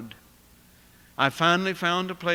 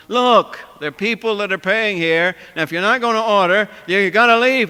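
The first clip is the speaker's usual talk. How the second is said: real loud; 3 semitones higher, 9 dB louder than usual. Retold or imitated. Cook ordering the non-paying woman out, angry shout. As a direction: throw her out, full volume. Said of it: "he got real loud about it".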